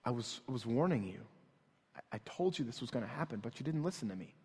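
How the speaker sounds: soft, low, tired i-told-you-so; retold quiet warning. Father to calmed son, quiet reproach. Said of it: "I say these words to him".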